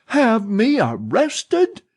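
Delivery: slow, high, loud indignant spit; spitting outrage. Acted. Old woman spits outrage at the manager's threat. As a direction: spit each word in his face. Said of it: "seemed to spit the three teeth".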